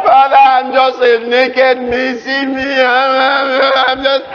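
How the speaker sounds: hammed-up tearful pleading; crying. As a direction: whine and stammer like a mock sobber. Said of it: "does not change because of your crying".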